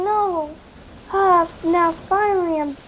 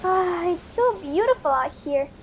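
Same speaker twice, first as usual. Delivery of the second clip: ordinary delivery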